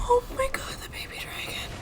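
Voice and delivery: breathless whisper of dismay; barely voiced, stunned; shocked whisper. Real, not acted. Shocked whisper at dragon's death.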